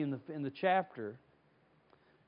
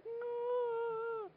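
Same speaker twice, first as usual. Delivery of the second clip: much higher, hushed scared little cry; frightened cry; 7 dB quieter than usual. Retold or imitated. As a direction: yelp softly in fear.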